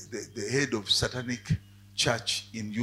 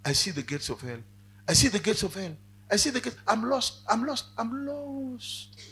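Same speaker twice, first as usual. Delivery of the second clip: rising higher with each repeat, desperate; terrified cry; 10 semitones higher than usual. Retold or imitated. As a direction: panic growing, voice climbing. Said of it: "he cried out".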